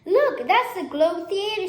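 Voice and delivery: lightly voiced proud lion; chatty tour-guide lion. A narrator's reading. Lion character voice in story.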